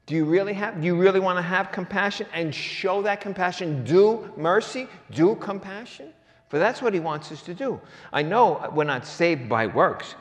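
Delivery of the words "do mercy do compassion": "Do" drawn out slowly and punched in "Do mercy"; stress on DO. Real, not acted. Preacher urging people to show mercy, stress on DO. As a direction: lean hard and high on each "Do". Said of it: "the emphasis on that word "do".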